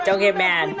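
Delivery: normal talking voice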